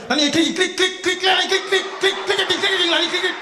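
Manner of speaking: high, fast clicking patter; rapid-fire instructions. Acted. IT guy rattling instructions fast.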